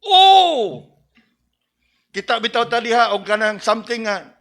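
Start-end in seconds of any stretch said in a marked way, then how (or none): 0.0-0.9 high loud startled oh; sudden alarmed exclamation; shocked cry. Shown as a demonstration. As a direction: gasp at an accident. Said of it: "somebody would say".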